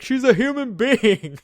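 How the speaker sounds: a hammed-up scream for the girl to stay; mock scream. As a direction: yell it like the dad did, drawn out. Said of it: "her dad was the dude who screamed".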